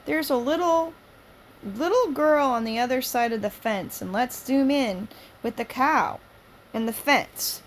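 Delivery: loud, high lively read-aloud; lively story reading. Acted. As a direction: read it out brightly to the room.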